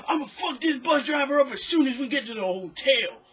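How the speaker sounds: high, loud mock threat; angry tourist.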